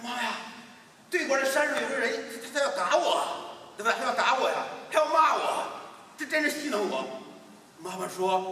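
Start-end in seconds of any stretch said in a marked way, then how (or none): 0.1-7.2 put-on high whiny child telling mom; upset child. Boy runs home to tell his mother, high upset voice. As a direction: complain like a frightened kid.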